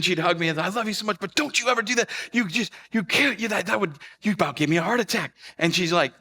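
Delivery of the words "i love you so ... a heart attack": high, worked-up mock mom scolding; loving chastising. Mom to her found son, chastising with love. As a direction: scold and hug in the same breath, pitched high. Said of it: "chastising me and hugging me at the same time".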